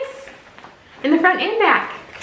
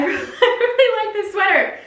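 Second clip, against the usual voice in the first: laughing; 9 semitones higher than usual. Real, not acted. Laughing, in love with sweater.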